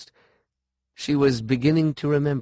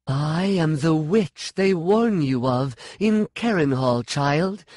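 higher, proud cry of the witch; exulting yell; 6 semitones higher, slower than usual. A narrator's reading. The woman proclaiming herself a witch to Sean, exulting. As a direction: proclaim yourself in triumph. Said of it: "she yelled, exulting".

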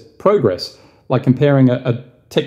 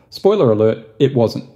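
ordinary talk

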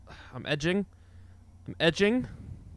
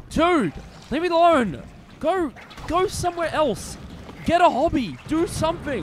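high-pitched, fed-up scolding; exasperated pleading; 10 semitones higher than usual. Pretend. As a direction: whine at a pest to leave you alone. Telling the monster to go away.

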